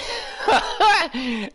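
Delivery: high burst of laughter. Real, not acted. Player laughing at own selfish plan, burst of laughter. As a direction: crack up at the idea.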